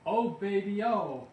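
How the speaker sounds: high, loud gloating; loud delighted exclamation; gleeful whoop. A narrator's reading. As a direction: crow over the money rolling in. Gloating over business growth.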